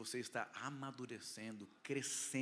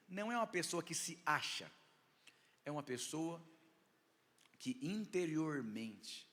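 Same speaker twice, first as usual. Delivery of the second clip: fiery preaching; 4 semitones higher, 4 dB louder than usual. Acted. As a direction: preach it out with full force. Preaching builds to full force. (pregação inflamada)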